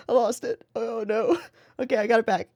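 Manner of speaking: giggling. Real, not acted. Laughing fit while recovering.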